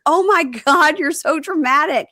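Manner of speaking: high-pitched mock exasperation; exasperated. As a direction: retell her scolding, high and exaggerated. Acting out her exasperated reaction.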